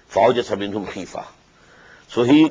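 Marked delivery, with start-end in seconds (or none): 0.1-1.2 rapid Quranic recitation; quick recitation. Acted. Teacher reciting the verse quickly before explaining. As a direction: rattle off the verse briskly.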